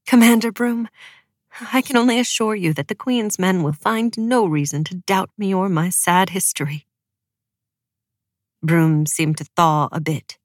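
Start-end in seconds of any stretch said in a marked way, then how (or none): none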